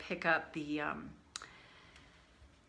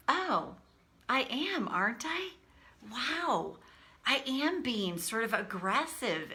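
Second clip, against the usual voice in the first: higher-pitched dawning surprise; retold realisation; 5 semitones higher than usual. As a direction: act out catching yourself in the act. Acting out her sudden self-realisation.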